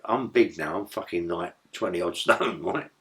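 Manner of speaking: ordinary talk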